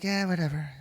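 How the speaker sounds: mock carefree sing-song, kept quiet; mock nonchalance. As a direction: softly imitate someone strolling without a care. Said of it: "walking around ... just like".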